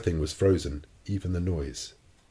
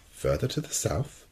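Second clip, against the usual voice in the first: slow, softer questioning tone; mild surprise; 3 semitones higher, 5 dB quieter than usual. A narrator's reading. Professor questions the captain's claim, mildly surprised. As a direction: slowly question it, taken aback.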